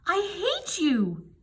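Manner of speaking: high furious scream, slow; shrieking I hate you. A narrator's reading. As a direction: scream it at her in a rage. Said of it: "Anne screamed".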